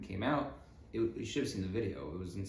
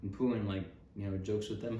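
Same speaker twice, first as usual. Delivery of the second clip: ordinary talk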